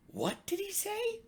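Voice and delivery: high, loud puzzled frog voices; frogs crying out. A narrator's reading. Frogs at the pond crying out, high and puzzled. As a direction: cry out, baffled. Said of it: "cried the frogs".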